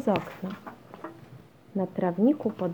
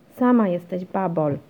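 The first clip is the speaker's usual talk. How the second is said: put-on louder, irritated boy's retort; annoyed; 7 dB louder than usual. A narrator's reading. Janek snaps back at his teasing sister, annoyed. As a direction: throw the insult back, louder. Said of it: "zdenerwował się Janek".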